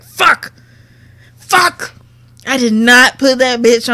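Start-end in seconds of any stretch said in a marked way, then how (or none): 0.0-1.9 loud, high retold outburst; frustrated cursing. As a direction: curse as if just remembering, dismayed. Retells realizing charger was forgotten.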